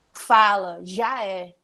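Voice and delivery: Carioca accent, a touch of mock attitude; carioca tough slang. Shown as a demonstration. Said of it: "parece que eles estão brigando". (sotaque carioca, meio briguento)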